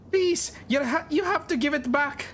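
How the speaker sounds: high-pitched, distressed begging; pleading. Acted. Robbed woman begs bandits for her goods, pleading. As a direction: plead high, stumbling on the words.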